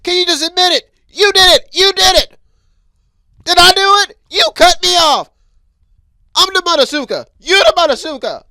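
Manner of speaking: loud, high furious back-and-forth yelling; road rage quarrel. Pretend. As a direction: argue with the driver who cut you off. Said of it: "if you cut somebody off".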